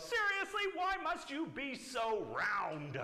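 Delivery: high, loud, exasperated mock yell; fed-up bunny yelling, hammed up for kids; escalated storybook yell. A narrator's reading. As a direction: shoot the pitch way up, then stretch the words. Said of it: "And she yells".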